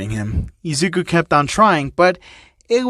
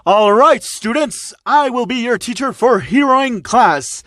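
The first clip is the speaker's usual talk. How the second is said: All Might; 6 semitones higher than usual. Acted. All Might voiced in reading.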